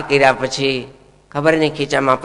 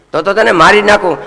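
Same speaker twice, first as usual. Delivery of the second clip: higher and louder, an outraged ruler; angry king; 5 semitones higher, 6 dB louder than usual. Acted. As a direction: thunder like a king defending his animals. King threatens Kali.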